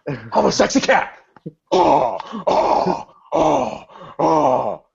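hammed-up impression, drawn-out wailing groans; Nicolas Cage. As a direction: go unhinged, high swooping celebrity impression. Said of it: "that's my Nick Cage".

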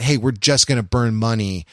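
ordinary delivery

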